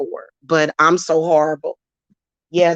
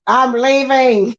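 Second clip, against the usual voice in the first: high, louder and slow I'm leaving; mock careless exit; 6 semitones higher, 3 dB louder, slower than usual. Mimicking him walking out on everyone, slow mock announcement. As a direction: drag out leaving in a high, mocking voice. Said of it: "and then you go".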